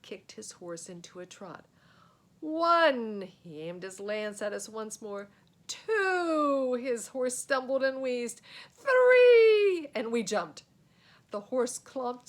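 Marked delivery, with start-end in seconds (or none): 2.4-3.2 louder called count; shouted count. A narrator's reading. Counting before the jump. 5.7-6.7 louder called count; shouted count. A narrator's reading. Counting before the jump. 8.7-9.9 much higher, much louder yell; shouted count. A narrator's reading. Counting before the jump.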